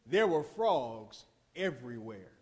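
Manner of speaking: dropped low and soft, almost a whisper; hushed. Acted. Preacher hushing the congregation to picture it, low and soft. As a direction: let it sink in, low and quiet. Painting the plague for listeners.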